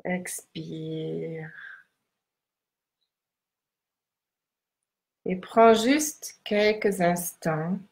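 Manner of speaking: slow down and guide the breath; guided meditation. Acted.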